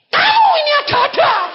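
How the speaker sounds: high, exasperated retold shout; sudden yell. Guide scolding the man in dark glasses, high exasperated yell. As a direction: burst out at the top of the range. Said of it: "aku langsung teriak".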